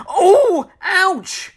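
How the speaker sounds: very high, loud acted "ow"; cartoonish yelp of pain. A narrator's reading. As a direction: cry out at a stamped foot. Mister Happy's foot is stepped on.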